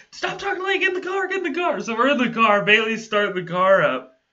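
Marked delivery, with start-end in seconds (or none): 0.1-1.8 high, urgent hush; urgent hushed panic. Retold or imitated. Urging their friend into the car, high and urgent. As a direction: hurry him into the car. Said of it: "Me and Bailey are like".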